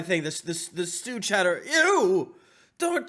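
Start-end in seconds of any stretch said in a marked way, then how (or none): 1.7-3.1 disgusted. Acted. Chef sees rats.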